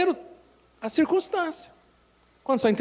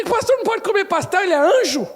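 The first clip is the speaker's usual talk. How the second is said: mock scandalized member; 7 semitones higher, 4 dB louder than usual. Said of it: "Aí".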